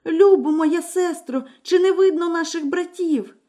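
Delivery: high, calling anxiously up the tower; frightened wife begging for news; anxious young wife. Acted. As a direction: call out to her sister, desperate. Wife calls to sister.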